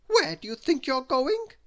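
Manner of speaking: high, loud challenge; loud bossy demand; Little Miss Bossy. A narrator's reading. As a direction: call out bossily, pitched well up. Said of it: "cried Little Miss Bossy".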